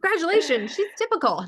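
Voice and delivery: laughing congratulations; amused. Real, not acted. Joking reply to parent question.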